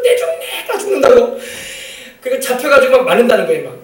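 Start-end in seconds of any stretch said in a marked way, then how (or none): 0.0-1.4 much higher, breathy wail with a laugh; half-laughing retold yelp of pain; mock cry of pain. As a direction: play the beaten boy yelping. Boy crying out while beaten.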